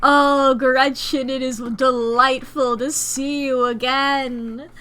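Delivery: slow, loud, delighted hello to a dog; delighted greeting. Real, not acted. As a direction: gush over the dog slowly.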